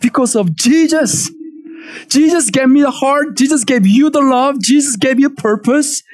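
ordinary delivery

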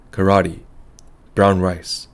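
ordinary delivery, nothing marked

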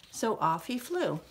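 ordinary delivery, nothing marked

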